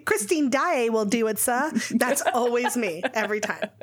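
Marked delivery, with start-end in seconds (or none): 0.0-1.7 put-on eager Cockney kid from a musical; put-on Cockney urchin; Cockney accent. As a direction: pipe up like a little Cockney messenger. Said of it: "the little Cockney fucker that runs in".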